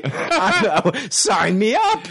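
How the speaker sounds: laughing through the words. Real, not acted. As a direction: laugh as you say it. Laughing while joking.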